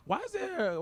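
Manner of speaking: very high-pitched baffled question; mock incredulous. Coming to his senses at the comment bar, incredulous squeak. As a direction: let the pitch leap up in disbelief.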